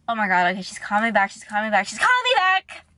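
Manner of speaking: re-enacted mounting excitement; building panic. Retold panic as phone rings.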